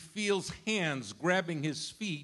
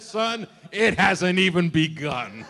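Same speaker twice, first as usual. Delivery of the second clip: laughing reply; 8 dB louder than usual. Retold or imitated. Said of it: "And I said".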